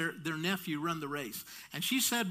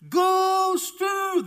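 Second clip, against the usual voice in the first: very high, loud, sustained holler; mom's cheering yell; 13 semitones higher, 10 dB louder than usual. Retold or imitated. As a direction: imitate a sports mom yelling to her son. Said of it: "That's all she would say".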